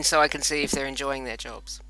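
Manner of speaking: ordinary delivery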